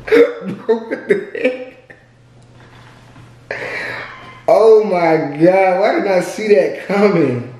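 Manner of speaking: loud laughing shock. Real, not acted. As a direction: laugh out the words, stunned. Spat water in his face.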